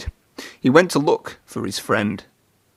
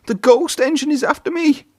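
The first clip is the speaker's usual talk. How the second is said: high panicked cry; frightened cry. A narrator's reading. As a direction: cry it fleeing in fear. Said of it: "he cried".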